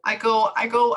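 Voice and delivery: usual speaking voice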